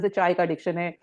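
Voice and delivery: ordinary talk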